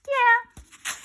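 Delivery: loud, high yeah; eager yeah. Acted. Kids eagerly answering the rapper, loud and high. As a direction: shout it back excitedly.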